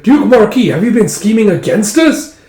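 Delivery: loud, high-pitched challenge; angry accusation. Acted. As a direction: accuse him loudly. Uncle accuses the duke.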